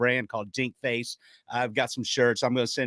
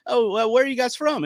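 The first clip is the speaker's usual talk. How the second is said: "Oh, well, where are you guys from?" pitched much higher, friendly; chatty stranger; 10 semitones higher, 4 dB louder than usual. Retold or imitated. Co-host retelling a stranger at the bar asking, in a high friendly voice. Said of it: "the lady goes".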